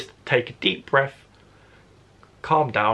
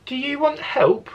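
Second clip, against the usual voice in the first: put-on much higher, childlike Jack asking gently; young boy voice; 13 semitones higher than usual. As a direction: pitch it up like a small boy offering help. Boy character offers help.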